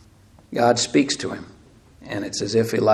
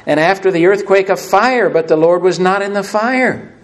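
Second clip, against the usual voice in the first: raised, louder narration of wind, quake and fire; dramatic scripture reading; 5 semitones higher, 5 dB louder than usual. Real, not acted. Preacher reading God's passing to the congregation, declaimed. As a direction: declaim the verses with mounting force.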